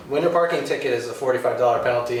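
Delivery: ordinary talk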